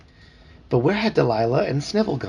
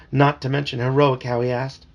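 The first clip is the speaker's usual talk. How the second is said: usual speaking voice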